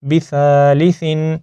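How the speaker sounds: بِثَالِثٍ slowly, with a long "tha"; elongated THA. Shown as a demonstration. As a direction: stretch the "tha" in بِثَالِثٍ, ending on n. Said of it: "یہاں پر بھی سا کو لمبا کیا ہے". (بِثَالِثٍ میں ثا کو لمبا کر کے)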